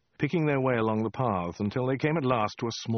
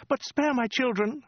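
very high falsetto plea; stammering woman; 3 dB louder than usual. A narrator's reading. Woman begging the Khan for her children, high stammering plea. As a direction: plead in a squeaky high woman's voice. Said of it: "Stammered the woman".

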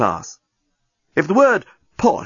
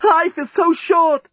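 high, drawn-out cry; impassioned wail; slower than usual. A narrator's reading. Lover facing the explosion, crying out. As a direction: wail it passionately.

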